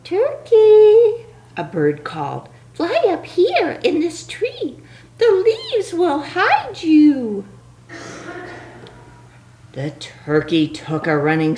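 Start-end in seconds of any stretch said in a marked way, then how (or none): 0.0-1.2 high bird voice. Acted. Bird character calling. 2.7-7.5 high bird voice. Acted. Bird character speaking.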